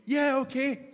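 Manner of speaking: high, loud, eager yes; loud high-pitched eager agreement; eager Peter. Acted. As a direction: jump at the invitation. Peter eagerly accepts the call.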